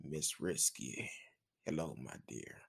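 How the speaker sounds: hushed, deep seductive soul-singer voice. Acted. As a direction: drop deep and quiet, crooning a greeting.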